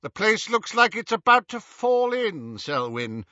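cool voice. A narrator's reading. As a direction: remark coolly to a partner. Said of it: "said a cool second voice".

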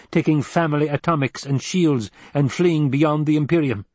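precise, high-voiced scheming counsellor; analytical mentat. Voices Piter the mentat advising.

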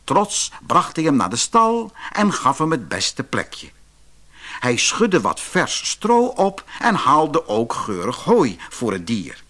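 ordinary delivery

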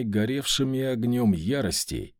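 ordinary delivery

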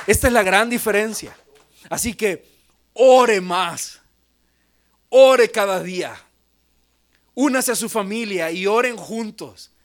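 loud, urgent pulpit call; forceful exhortation. Acted. Exhortation to pray. (exhortación enérgica a orar)

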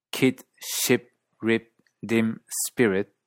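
Australian Kit, Ship, Rip, Dim, Spirit, vowel almost 'ee'; Australian accent. Shown as a demonstration. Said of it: "ciertos acentos australianos".

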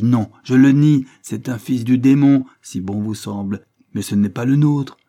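loud, high raging denial; furious shout. A narrator's reading. Judge disowning his son, loud furious shout. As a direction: roar it out in fury.

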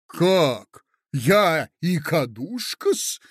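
loud, slow indignant question; mild outraged squeal. Acted. Lady stung by the insult, mild outraged squeal. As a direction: squeal in offence, drawing it out. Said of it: "взвизгнула Наталья Дмитриевна".

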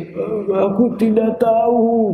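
mild mock crying, slow and drawn out. Shown as a demonstration. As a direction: say it slowly as if about to cry. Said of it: "tapi kayak nangis gitu". (suara pura-pura menangis pelan)

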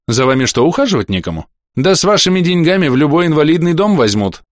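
a hint of a blunt stern physician; stern doctor voice. Доктор отвечает на угрозы. (лёгкий голос хмурого доктора)

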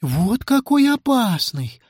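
high, slow nurse's pronouncement; concerned nurse, drawn-out high voice; Софочка. Acted. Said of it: "констатировала медсестра Софочка".

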